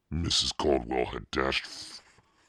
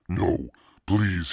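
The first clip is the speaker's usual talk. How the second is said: higher, louder terrified begging; frightened plea; 6 semitones higher, 6 dB louder than usual. A narrator's reading. Boy begging the masked monster, terrified plea. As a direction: cry out for mercy. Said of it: "he cried".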